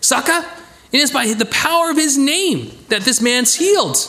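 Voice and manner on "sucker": much higher, punchy accusation as Peter; bold Peter confronting. Acted. Peter accusing the rulers, bold and defiant. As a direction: throw it in their faces like a fearless apostle. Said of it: "He's like".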